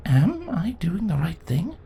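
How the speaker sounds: unvoiced whisper to herself; whispered doubt. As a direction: breathe the worry, barely voiced. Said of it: "She thought to herself".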